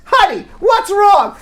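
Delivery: hammed-up puzzled husband; clueless husband. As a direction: play the oblivious husband, drawn out. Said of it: "And he says".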